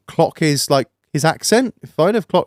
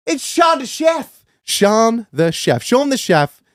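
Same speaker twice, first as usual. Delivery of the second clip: slow, high, drawn-out sponsor call; announcer hype; 7 semitones higher, slower than usual. Acted. Plugging the sponsor to listeners, slow hyped announcer call. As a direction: announce the brand like a ring MC.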